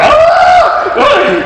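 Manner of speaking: high, loud fake scream into a laugh; faked early scream. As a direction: fake the strain, overdo it. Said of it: "start screaming early".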